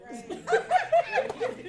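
high-pitched laugh. Real, not acted. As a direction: laugh at your own joke. Laughs after own accent joke.

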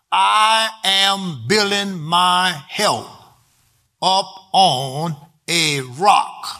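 very slow, word-by-word delivery; slow, deliberate statement to be written down; slow dictation. Shown as a demonstration. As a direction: dictate it for note-takers, spacing out each word. Write that down.

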